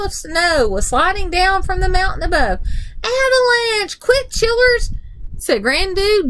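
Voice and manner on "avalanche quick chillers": high-pitched cry of alarm; urgent warning. A narrator's reading. Granddude warning children of an avalanche, urgent high cry. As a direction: warn them urgently in a much higher voice.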